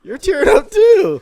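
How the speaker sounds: high, loud tease breaking into laughter; laughing accusation. Real, not acted. Accusing him of crying from laughter too, laughing loudly. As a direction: shout it out through a laugh. Teasing each other amid laughing fit.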